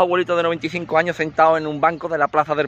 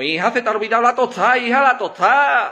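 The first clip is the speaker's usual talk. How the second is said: triumphant gotcha; 8 semitones higher than usual. Acted. Old man mocks forgetful wife.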